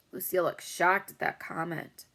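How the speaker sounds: ordinary delivery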